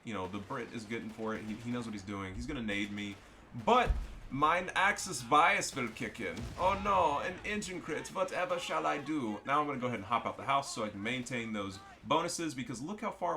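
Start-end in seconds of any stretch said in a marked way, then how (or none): 3.6-9.4 slow, high, hammed-up German accent. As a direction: play it as a theatrical German. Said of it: "I'm doing the German accent".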